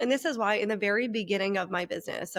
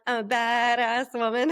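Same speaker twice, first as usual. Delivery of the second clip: badass woman act; 2 semitones higher, 4 dB louder than usual. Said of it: "It could be I'm putting on".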